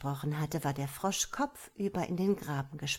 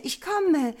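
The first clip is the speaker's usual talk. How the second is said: high, loud ringing shout repeated; ringing call; 11 semitones higher, 8 dB louder than usual. A narrator's reading. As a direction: keep calling out like the sun. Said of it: "rief mit schallender Stimme". (hoch und laut schallender Ruf, wiederholt)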